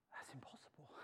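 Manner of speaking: breathy whispered doubt; a hushed, defeated whisper; hopeless whisper. Acted. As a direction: whisper it as if it can't be done. Whispered hopeless reply to Jesus.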